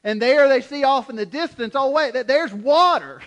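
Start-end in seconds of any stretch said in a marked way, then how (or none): 1.7-3.2 excited discovery. Acted. Thirsty people spot distant water.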